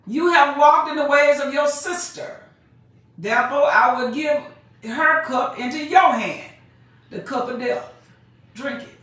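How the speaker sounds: preacher reading doom; scripture reading. Acted. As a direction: pronounce judgment from the pulpit. Preacher reads scripture aloud.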